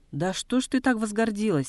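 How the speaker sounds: storyteller's restrained angry shout; angry cry. A narrator's reading. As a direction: narrate an indignant demand, voice lifted. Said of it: "сердито закричал Гамбо".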